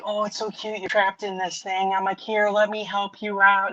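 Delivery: high coaxing animal talk; retold cooing. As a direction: coax a little critter in a high voice. Retells cooing at trapped skunk.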